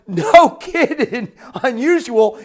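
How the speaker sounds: amused disbelief. Acted. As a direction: throw it back with an astonished laugh. Acted amazed reply to cabbie.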